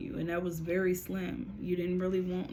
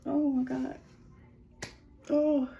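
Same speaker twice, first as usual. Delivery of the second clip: high, loud gasp of Oh my God; startled, rising exclamation; real surprise; 8 semitones higher, 6 dB louder than usual. Real, not acted. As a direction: caught off guard by what she hears. Medium reacts to the message.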